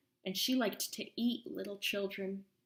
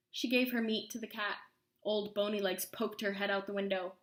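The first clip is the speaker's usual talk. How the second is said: ordinary delivery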